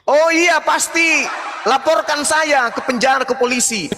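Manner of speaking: high-pitched, defiant call; real heated challenge; defiant outburst. Real, not acted. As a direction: raise the pitch and dare them to report you. Defiant reply to opponent's challenge.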